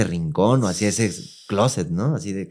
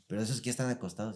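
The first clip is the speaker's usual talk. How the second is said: ordinary delivery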